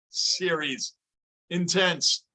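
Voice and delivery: ordinary talk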